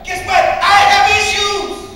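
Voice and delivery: high, loud shout of admission; loud confession. Real, not acted. Loud self-mocking confession.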